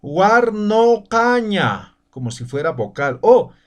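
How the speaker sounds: guarnocaña said slowly, u opened toward o; careful teacher demo of guarnocaña; slow pronunciation demo. Shown as a demonstration. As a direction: model guarnocaña with an o vowel. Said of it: "yo voy a pronunciar".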